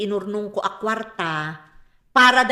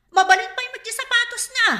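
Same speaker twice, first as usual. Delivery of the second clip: high, louder, fed-up protest; exasperated scolding; 11 semitones higher, 4 dB louder than usual. Acted. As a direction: throw the complaint up in disbelief. Wife scolds husband over shoes.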